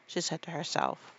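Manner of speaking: plain reading voice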